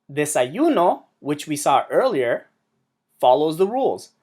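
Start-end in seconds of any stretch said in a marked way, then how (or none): 0.1-1.0 "Desayuno" as de-sa-YU-no; "Desayuno" with a light final no; stress on second-to-last syllable. Shown as a demonstration. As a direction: lift the yu of "Desayuno". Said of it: "emphasizes the second to last syllable".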